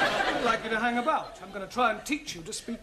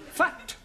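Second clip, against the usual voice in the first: "Fat" said louder and with a laugh, stressed; corrective emphasis; 6 semitones higher, 3 dB louder than usual. Shown as a demonstration. Teacher stressing the word for the fumbling student. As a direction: hit "Fat" hard to correct him. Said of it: "No, not fat".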